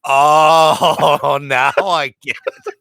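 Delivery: long, loud "oh" of understanding; dawning realization. Retold or imitated. Mitch finally understanding Bill's joke, dawning realization.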